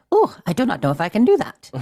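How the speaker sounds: hammy French lilt for Curie; French accent. Retold or imitated. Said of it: "was that your French accent?".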